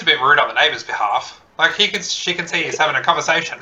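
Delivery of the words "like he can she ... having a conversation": ordinary talk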